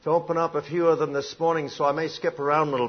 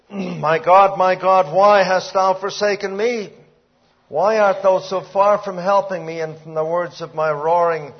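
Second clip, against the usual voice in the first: loud, raised preacher voice crying out the psalm; preaching at full force; 5 semitones higher, 8 dB louder than usual. Acted. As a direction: proclaim it to the whole hall.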